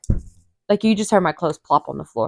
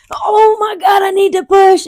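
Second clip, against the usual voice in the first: much higher, louder shout; urgent shout; 13 semitones higher, 4 dB louder than usual. Retold or imitated. Woman in labour to the nurse, urgent high shout. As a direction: yell it out like the baby's coming now. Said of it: "and I'm like".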